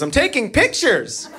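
high, slow mimicked wife; imitated wife. Wife answering from the front of the canoe, imitated high. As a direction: draw it out in a mock high voice. Wife's oblivious reply, acted.

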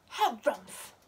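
loud huffy grumble of a harumph; grumpy harumph. A narrator's reading. Reading to children, Pom Pom's grumpy harumph. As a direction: huff it out like a cross little bear. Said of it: "said Pom Pom".

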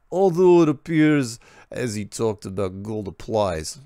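put-on slow, light Croatian accent; mild Croatian accent. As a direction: keep reading in the mock Croatian accent. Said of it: "I'm going to read this now in the accent".

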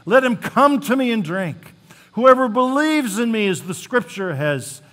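high, loud proclamation. Acted. Jesus calling out to the festival crowd, loud and high. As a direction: call it out to the whole crowd. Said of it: "Jesus said in a loud voice".

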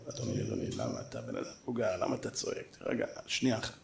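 very low, hushed, unruffled; calming down. Retold or imitated. Speaker soothes the shouting elderly father, very quiet and calm. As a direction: murmur it to settle an angry man.

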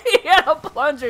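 high, loud laughing shout; laughing disbelief. Real, not acted. Teammate's funny plunger play.

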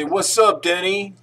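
loud, high call-out; loud prompt. Shown as a demonstration. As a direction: call it out for him to copy.

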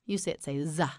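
normal talking voice

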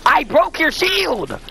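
high, incredulous outburst; exasperated. Real, not acted. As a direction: hammer home that you won. Arguing over a game hit.

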